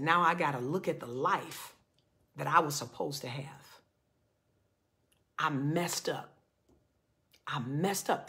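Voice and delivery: low, soft regret with heavy pauses; almost in tears. Retold or imitated. Man mourns his lost married life, retold as almost in tears. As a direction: imitate a defeated man, hushed. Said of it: "he's almost in tears".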